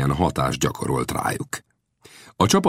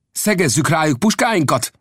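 raised, urgent call to aim rifles; shouted order; 12 semitones higher than usual. A narrator's reading. Old Death shouts to his men to aim at the Comanches. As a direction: bark the command to his men. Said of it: "kiáltotta Old Death".

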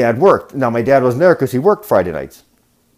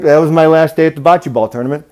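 normal talking voice

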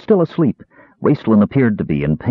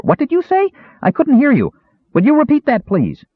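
much higher, loud and eager; loud kender voice. A narrator's reading. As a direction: chirp it innocently at full volume. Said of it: "his voice just as loud as before".

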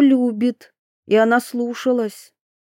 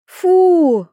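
high, loud, falling cry of revulsion; disgusted exclamation; 8 semitones higher, 6 dB louder than usual. A narrator's reading. Narrator voices a girl finding grassy stains, disgusted cry. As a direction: burst out with disgust.

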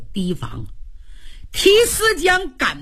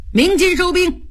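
high-pitched commander's call; military order; 8 semitones higher than usual. A narrator's reading. Luo Cheng orders troops to withdraw, high commanding call. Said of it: "吩咐军兵".

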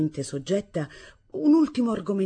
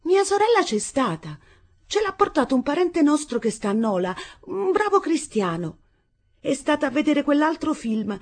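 put-on unsure young bride; 8 semitones higher, 5 dB louder than usual. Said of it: "mal sicura".

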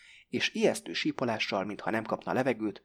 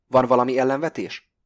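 a louder, pointed challenge; cool challenge; 2 semitones higher, 9 dB louder than usual. A narrator's reading. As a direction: throw the question out to the room. Robber challenges the hostages.

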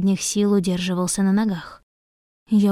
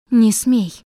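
sharp command; 3 semitones higher, 6 dB louder than usual. A narrator's reading. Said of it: "почти не разжимая губ, обронила я".

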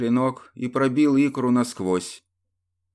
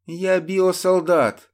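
smirking; 9 semitones higher, 5 dB louder, slower than usual. Acted. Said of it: "усмехнулся ему в лицо убийца".

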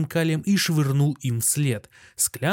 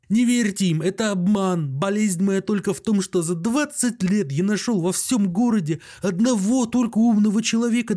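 high, insistent, agitated voice; desperate doctor; 6 semitones higher than usual. A narrator's reading. Doctor insists to friend he is not ill, desperate. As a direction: pour out a despairing protest.

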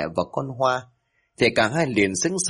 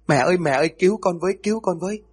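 terrified scream for help; 8 semitones higher, faster than usual. A narrator's reading. Girl snatched by ghost screams to mother, terrified. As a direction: shriek for mother in terror. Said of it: "hét lên lạc cả giọng".

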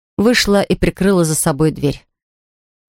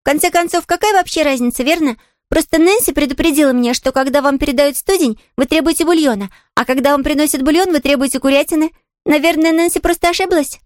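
put-on high, artless child's explanation; chatty little girl; 8 semitones higher than usual. Girl explaining Nancy's warning to the invalid, chatty. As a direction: explain it away in a high girlish voice.

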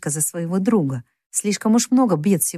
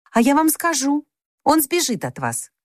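put-on young girl blurting, higher voice; girl's warning, pitched up; 7 semitones higher than usual. A narrator's reading. Jo warns grandfather.

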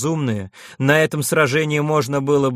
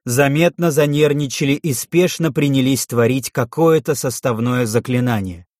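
plain reading voice

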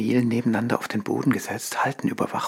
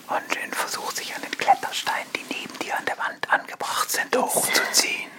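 whisper. Acted. Narrator describing Onjin climbing, whispered. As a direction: murmur it without voice.

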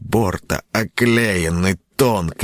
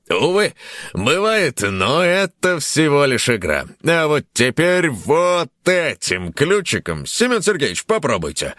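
game show host; 9 semitones higher than usual. Acted.

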